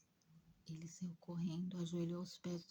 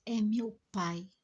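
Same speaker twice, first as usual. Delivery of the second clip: loud, slow, anguished cry of recognition; anguished outcry; 4 semitones higher, 10 dB louder, slower than usual. Retold or imitated. Spirit realizing his father killed his love, loud anguished cry. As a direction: cry it out in shock.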